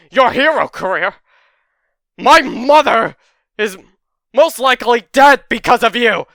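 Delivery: screamed high and loud, full rage; furious shout. Acted. Izuku screaming at Bakugo over his mother. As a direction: explode at him, voice cracking with fury. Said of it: "Izuku sort of just snaps".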